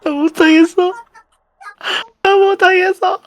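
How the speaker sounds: high, tearful plea to give up; scared whining. Real, not acted. Scared player wants to quit. (겁에 질려 울먹이는 소리)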